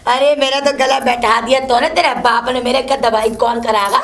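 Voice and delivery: ordinary talk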